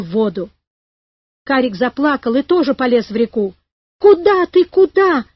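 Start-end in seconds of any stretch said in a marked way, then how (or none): 4.0-5.2 high, loud startled cry; raised voice, urgent and high; alarmed shout. A narrator's reading. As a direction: call out sharply to stop him. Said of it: "крикнул профессор".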